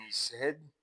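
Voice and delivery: measured, rhythmic poem reading; bush ballad recitation. A narrator's reading. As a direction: recite it like an old campfire yarn.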